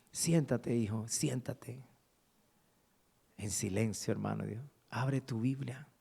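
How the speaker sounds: soft, slow, half-whispered urging; quietly sit him down, gravely; a hushed pastor breaking bad news.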